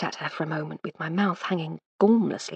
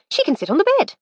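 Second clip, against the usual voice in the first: high, bright-pitched lady's voice, louder; 6 dB louder, faster than usual. Acted. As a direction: brush it aside as the lady of the house. Lady Hardcastle's voice continues.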